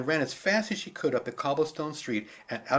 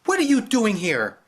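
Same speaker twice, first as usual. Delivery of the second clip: put-on loud, higher girl's yell; angry child's yell; 8 semitones higher, 8 dB louder than usual. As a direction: yell it at the man. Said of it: "yelled at the man".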